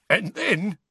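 high, choked storyteller's voice; choked with laughter. A narrator's reading. Rogue telling a funny tale at table, choked with laughter. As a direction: squeeze it out through mirth. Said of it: "choked Mundungus".

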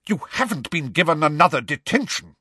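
high, stern teacher voice; stern professor. A narrator's reading. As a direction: challenge the pupil at once. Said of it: "her square spectacles flashing alarmingly".

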